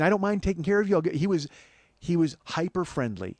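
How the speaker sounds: ordinary talk, nothing marked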